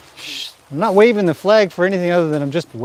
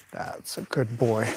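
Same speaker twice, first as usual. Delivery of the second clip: softer and slower, gentle praise; 5 semitones lower, 11 dB quieter, slower than usual. Real, not acted. Trainer praising her horse after work, soft and slow. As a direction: murmur it soothingly to the horse.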